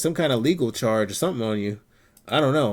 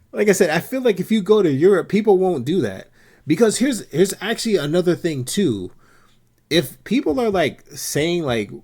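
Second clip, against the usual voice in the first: louder and higher, pressing the point; fired-up argument; 6 semitones higher, 5 dB louder than usual. Real, not acted. Arguing to a friend that Europe would not tolerate this, louder and higher. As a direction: push the point with heat.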